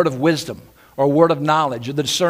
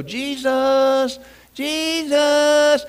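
high, half-hearted calling of the name; a polite little call nobody would notice; timid call; 10 semitones higher, much slower than usual. Shown as a demonstration. Preacher showing how Bartimaeus did not call, weak high call. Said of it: "he didn't just go".

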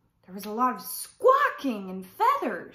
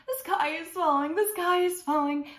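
higher-pitched mock shout of alarm; story-time shouting; 6 semitones higher, 5 dB louder than usual. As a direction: call it out like panicked storybook chickens. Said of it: "they went down the road shouting".

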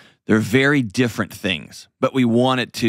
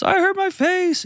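put-on very high little-kid wail; mock childish whine; whiny hurt child. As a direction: imitate a crying kid, pitched way up. Voices a crying hurt child.

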